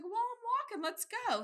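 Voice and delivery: high, insistent retold announcement; lightly acted determined mother; stubborn mom. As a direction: declare it high, ready to march off. Stubborn mother voiced in story.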